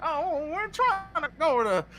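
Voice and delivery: high, sing-song Southern granny; imitated Southern old-lady voice; Southern grandma. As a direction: coax like a sweet Southern grandma. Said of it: "you made that just sound like a very, like, wise, like, Southern grandma".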